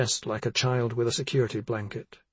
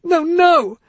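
shouting; 11 dB louder than usual. Acted. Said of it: "Missa shouted".

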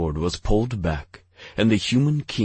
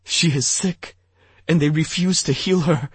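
high, strained sobbing voice; 11 semitones higher, 4 dB louder than usual. A narrator's reading. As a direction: plead through sobs. Sobbing king.

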